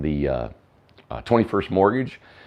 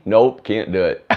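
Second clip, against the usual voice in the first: slow, laughing admission of defeat; 5 semitones higher, 6 dB louder, slower than usual. Real, not acted. Host gives up singing with a sore throat, laughing. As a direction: give up through laughter.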